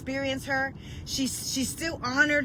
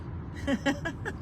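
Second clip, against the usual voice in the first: quick quiet chuckle; short soft laugh; 3 semitones higher, 6 dB quieter than usual. Real, not acted. Preacher delighted by her own message, short laugh. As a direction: laugh lightly to yourself.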